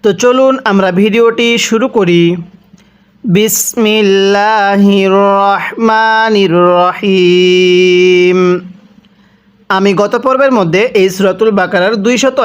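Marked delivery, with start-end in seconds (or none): 3.2-8.4 slow, drawn-out Quranic recitation; recited basmala. Shown as a demonstration. Teacher opening the Quran lesson, slow recitation. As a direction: chant it slowly like a reciter.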